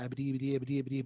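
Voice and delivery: quick mumbled stutter on "abity"; offhand cartoon stutter, "abity" repeated fast; Porky Pig. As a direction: stammer "abity, abity" rapidly and softly. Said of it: "Porky Pig, Mister Mumbles".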